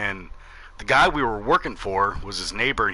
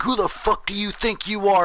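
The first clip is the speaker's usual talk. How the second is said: put-on high-pitched outraged old man; mock an indignant neighbor's challenge; imitated angry yelling; 10 semitones higher than usual. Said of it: "in my face screaming and yelling".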